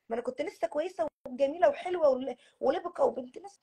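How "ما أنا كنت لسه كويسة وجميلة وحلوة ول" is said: ordinary talk, nothing marked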